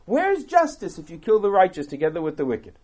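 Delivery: ordinary talk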